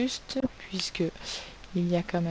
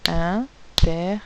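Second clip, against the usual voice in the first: "interdit" slow, in three separate beats; syllable by syllable; 5 dB louder, slower than usual. Shown as a demonstration. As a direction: count out "interdit" as in, ter, dit. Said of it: "Trois syllabes".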